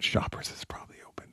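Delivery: whispered aside, barely voiced; hushed thought. Telling listeners a sudden thought, quick whisper. Acting out own hopeful thought.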